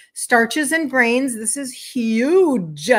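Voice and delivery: stretched out, leaning on huge; slow, weighty emphasis. Shown as a demonstration. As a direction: drag out starches and grains to stress them. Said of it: "slow emphatic list item".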